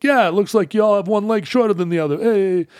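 high, mocking New York accent; New Yorker accent. New Yorker customer mocking Seattle's clunky shoes, in a New York accent. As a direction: needle the locals like a cartoon New Yorker. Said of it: "And he's like".

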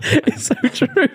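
high laughing agreement. Real, not acted. Cracking up at mate's joke, laughing high. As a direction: giggle it out up high.

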